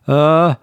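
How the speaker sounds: sharp loud startled "uh"; a sudden loud frightened grunt; loud panicked gasp of shock. Acted. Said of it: "somewhat panicked".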